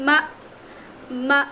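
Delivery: "মা" cut short on a glottal stop, said as an isolated drill; sukun drill. Shown as a demonstration. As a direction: stop "মা" abruptly on the hamza. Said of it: "এটা কি হামজা". ("মা" হামজা সাকিনে হঠাৎ থামিয়ে)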